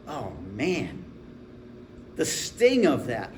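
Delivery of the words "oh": normal talking voice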